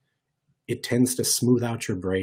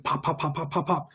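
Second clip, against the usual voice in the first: anxious racing brain; 7 semitones higher, 4 dB quieter than usual. Shown as a demonstration. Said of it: "when my brain starts getting very anxious".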